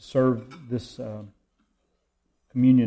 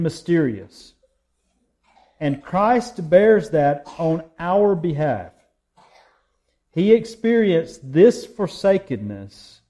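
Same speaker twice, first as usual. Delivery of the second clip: impassioned sermon, louder and ringing; preaching; 4 dB louder than usual. Acted. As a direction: preach the cross with fervor. Pastor preaching Good Friday sermon.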